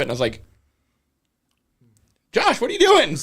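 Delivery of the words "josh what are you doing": laughing. Retold or imitated. Retold exasperated cry at Josh.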